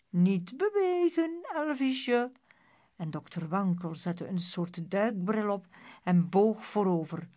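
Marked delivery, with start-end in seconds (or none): 0.1-2.3 high, sing-song coaxing; sinister sweet high voice; mock-soothing doctor. A narrator's reading. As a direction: coo at the patient as if to a small child. Doctor coos at his bound victim.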